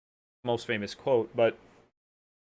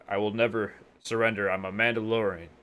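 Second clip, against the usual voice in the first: hurting Mandalorian, lightly acted; 5 semitones lower than usual. Wounded Mandalorian refusing to surrender, lightly acted hurt. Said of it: "when he's, like, hurting and whatnot".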